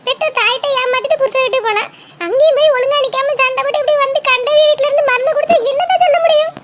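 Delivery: high, loud, exasperated outburst; agitated reproach. Acted. Friend berates the absent girl for taking poison, agitated reproach. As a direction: scold in alarm, pitched well up.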